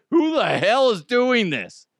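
loud, high-pitched exasperated demand; mock outburst at an unknown caller; pissed off. Pranked man demands who keeps calling, pissed off. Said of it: "I'd get really pissed off".

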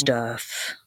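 low, ominous, drawn-out stuff; deep foreboding voice. Shown as a demonstration. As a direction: say "Stuff" like a doom warning. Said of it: "I say it in that, that deep, like, foreboding voice".

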